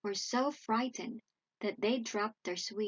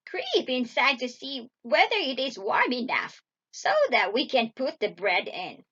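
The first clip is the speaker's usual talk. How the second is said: loud, wheedling witch voice; commanding witch; 7 dB louder than usual. A narrator's reading. Witch pushes Gretel towards the oven, loud sly command. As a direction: order her in, loud and sly.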